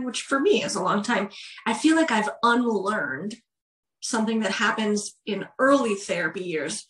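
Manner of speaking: usual speaking voice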